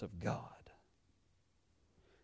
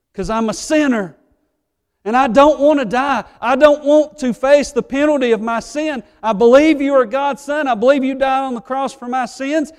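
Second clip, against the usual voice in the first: much higher and louder, a desperate sinner begging God; pleading prayer; 9 semitones higher, 10 dB louder than usual. Pretend. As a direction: plead with everything you have. Sinner praying for forgiveness.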